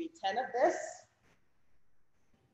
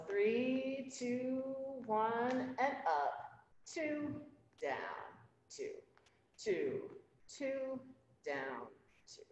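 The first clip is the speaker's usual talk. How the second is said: soft, steady rep-count cadence; counting workout reps; 7 dB quieter, much slower than usual. Real, not acted. Instructor counting exercise reps.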